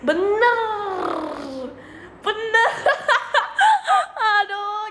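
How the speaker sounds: high laughing, nearly crying; helpless laughter. Real, not acted. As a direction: laugh until it cracks. Laughing at own failure.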